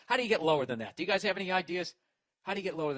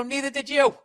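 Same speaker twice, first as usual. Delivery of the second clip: higher and louder smug retort; loud comeback; 9 semitones higher, 9 dB louder than usual. Retold or imitated. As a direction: fire it back, winning the argument. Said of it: "I go".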